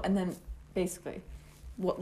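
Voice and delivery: normal talking voice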